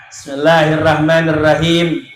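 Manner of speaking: drawn-out chanted greeting and praise; sermon opening. Acted. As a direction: open the sermon with melodic calls to the crowd.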